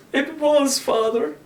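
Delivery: put-on a small boy choking back a sob. A narrator's reading. Boy answers the priest tearfully, with a sob. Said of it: "said I with a sob".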